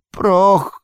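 high, loud hissed word; hissing Gollum. Acted. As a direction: hiss it like a wretched creature. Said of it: "syczał".

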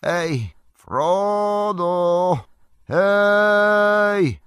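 high call as if from far away; faraway voice calling through mist; distant call. Acted. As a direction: hail him from a long way off. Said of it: "gdzieś daleko ktoś zawołał".

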